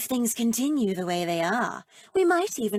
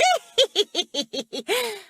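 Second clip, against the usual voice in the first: high, drawn-out evil cackle; practiced villain cackle; witch cackle; 10 semitones higher, 3 dB louder than usual. Acted. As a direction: cackle like a wicked witch in rehearsal. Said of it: "I was just practicing".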